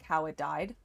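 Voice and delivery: usual speaking voice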